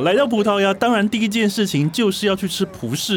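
高昂热情的导游腔，放慢点; 像带团导游一样介绍美食; tour guide voice. Acted. Travel-guide presenter. (对两位旅伴热情介绍葡式蛋挞，导游腔)

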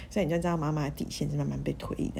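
ordinary delivery

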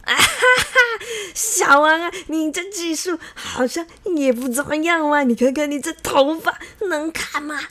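high, loud mocking cackle into speech; loud laughing. A narrator's reading. Li Da and Li Er taunt Xiao Wang, laughing loudly. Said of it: "大声的笑说".

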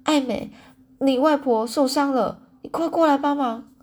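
frantic neighbor calling for help; flustered urgent call. A narrator's reading. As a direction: panic as you shout for help. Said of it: "气急败坏地说".

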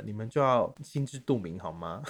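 ordinary delivery

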